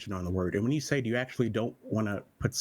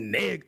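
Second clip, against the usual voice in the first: "Nig" pushed out higher and drawn out; raised, stressed "Nig", cut before the ending; emphasized; 7 semitones higher than usual. Shown as a demonstration. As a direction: lean hard on "Nig". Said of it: "that's emphasis".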